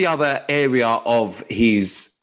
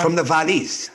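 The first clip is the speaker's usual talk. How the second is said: higher-pitched Welsh lilt; Welsh accent; 6 semitones higher than usual. Shown as a demonstration. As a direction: demonstrate a sing-song Valleys Welsh accent. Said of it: "do it for me".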